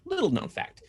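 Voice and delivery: high, breathy trivia-host voice; mock trivia voice. Shown as a demonstration. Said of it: "interesting fact about YouTube".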